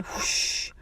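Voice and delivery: long airy whoosh; slow hissing release; whoosh of air. A narrator's reading. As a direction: imitate air rushing from a float. Imitates air rushing out.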